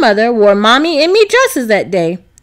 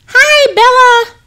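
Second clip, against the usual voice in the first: put-on high, slow, bright little girl's greeting; lightly voiced young girl calling hello; excited child voice; 13 semitones higher, 5 dB louder, slower than usual. A narrator's reading. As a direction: call out happily like a small girl. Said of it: "Sasha exclaimed".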